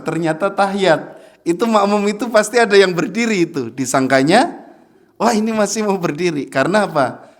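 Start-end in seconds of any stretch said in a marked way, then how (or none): none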